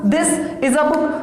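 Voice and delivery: slow, with "is a" run together as "iza"; slow linked demo. Shown as a demonstration. Teacher showing students how to link, slow and smooth. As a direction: join "is" and "a" into one sound. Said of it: "तो अब तुम क्या बोलोगे?".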